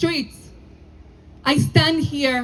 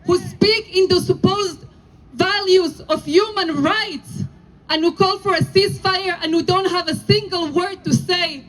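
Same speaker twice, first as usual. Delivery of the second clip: impassioned denunciation. Real, not acted. Rabbi denouncing protesters to the crowd, impassioned and forceful. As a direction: thunder shame at them.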